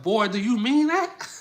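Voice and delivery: playful confrontational demand; bold challenge. Acted. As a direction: put them on the spot, loudly. Acting out women's direct challenge.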